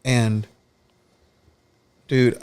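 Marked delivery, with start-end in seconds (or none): none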